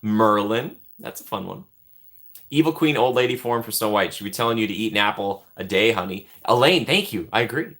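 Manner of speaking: ordinary talk, nothing marked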